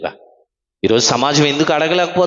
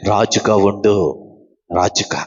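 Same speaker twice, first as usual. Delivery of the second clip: God declaring gently; 8 semitones lower than usual. Retold or imitated. God crowning Hezekiah. (దేవుని లోతైన, నెమ్మదైన ఆశీర్వాద స్వరం)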